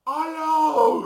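high, booming mock-shout hello; huge drawn-out comic bellow; bellowed phone greeting. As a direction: imitate someone roaring into a phone. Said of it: "çünkü çok ses çıkartacağım".

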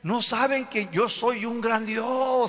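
high, slow, proud divine voice; God challenging the people, high and slow. Acted. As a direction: declare His greatness, slow and lofty. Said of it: "El Señor dice".

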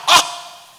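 high, loud shouted ah; sudden loud preacher's cry; preacher's exclamation. Acted. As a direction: let out a loud cry of emphasis. Preacher's cry after Paul's words.

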